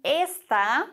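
Esta with stress on the first syllable, ÉS-ta; ésta, stressed E. Shown as a demonstration. Teacher showing ÉS-ta to German learners. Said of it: "Betonung auf dem E auch".

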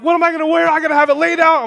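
high, loud mock panic; imitating a frantic over-planner; stressed out. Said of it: "stressed out".

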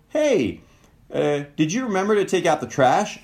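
high, sweet asking lilt; sweetest tone. Shown as a demonstration. Partner gently asking about the trash, sweet and high. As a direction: ask it as sweetly as you can. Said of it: "in your sweetest tone possible".

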